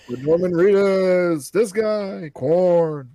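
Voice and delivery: loud, drawn-out cast roll call; mock TV announcer, stretched and projected; SNL announcer. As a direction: announce the names like a sketch show opener. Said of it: "opened it up with an S N L skit".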